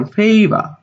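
favor said as "FEI-va", no final R; correct pronunciation demo. Shown as a demonstration. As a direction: model favor with a long first syllable, "feiva". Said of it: "সঠিক উচ্চারণ". (favor-এর উচ্চারণ "ফেইভা", শেষে র নেই)

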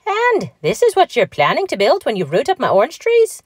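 high, swooping little-person voice; feisty little man. A narrator's reading. Patrick questions Mickey about the Disneyland plans, feisty. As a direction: question them with wary pride.

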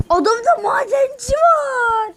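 high, slow, crying shout; mock despair wail. Real, not acted. Gamer spotting the opponent's miner, high mock wail. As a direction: wail like it's the end.

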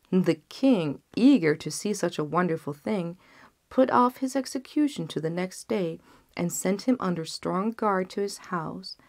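plain reading voice

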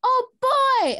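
put-on a high, loud delighted oh boy; excited squeal. Girl thrilled to get the party invitation. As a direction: burst out with joy.

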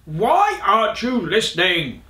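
loud storybook scolding; exasperated shout. A narrator's reading. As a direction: shout it like an impatient king. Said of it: "shouted the king".

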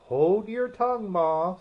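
put-on a young crab snapping at her mother; snappish. A narrator's reading. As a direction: snap back at a nagging mother. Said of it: "said the young crab a little snappishly".

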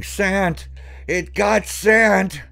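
high, loud terrified shout; a boy yelling to the whole camp that his friend was taken; panicked screaming. Acted. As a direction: scream for help in sheer panic. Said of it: "I started screaming".

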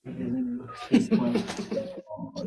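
long, high-pitched laugh; real laughter. Real, not acted. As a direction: burst out laughing at the question. Laughs at an awkward question. (long rire aigu)

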